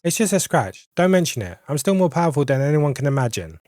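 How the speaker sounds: ordinary talk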